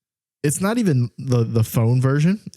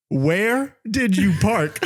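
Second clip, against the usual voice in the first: "Where did you park" slow and higher, laugh creeping in; every syllable emphasized; 6 semitones higher, much slower than usual. Retold or imitated. Repeating a text for Siri, every syllable of "Where did you park" emphasized. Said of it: "I emphasized every single syllable".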